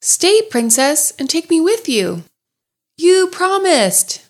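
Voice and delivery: high, slow pleading call; calling after. Acted. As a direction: call out after her, stretched. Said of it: "The frog called after her".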